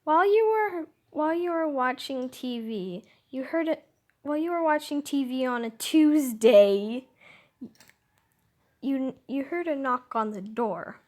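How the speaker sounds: loud, projected story reading; as loud as possible. Real, not acted. Reader narrating the knock on the door, as loud as possible. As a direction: read it out loudly, pushing the voice. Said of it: "Doing this as loud as possible".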